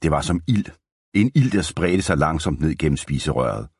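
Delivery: ordinary delivery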